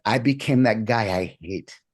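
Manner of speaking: usual speaking voice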